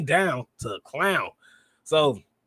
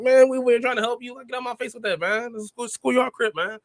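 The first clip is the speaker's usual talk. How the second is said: imitating Asuka pushing back; 9 semitones higher than usual. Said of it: "Asuka just pretty much pushed her like".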